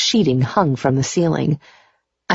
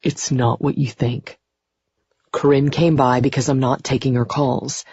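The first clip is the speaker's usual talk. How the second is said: sexy male voice. A narrator's reading. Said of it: "in that toe-curlingly sexy voice of his".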